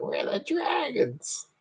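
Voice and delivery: very high, slow, quiet mock growl; hushed, squeaky dragon-gang voice; tough dragon gang. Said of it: "It sounds more like".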